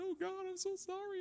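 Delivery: high, soft tearful wail; hammed-up crying plea; mock crying. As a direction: imitate sobbing an apology in a tiny high voice. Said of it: "and I cried".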